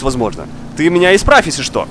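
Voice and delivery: ordinary talk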